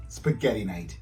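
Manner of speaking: plain reading voice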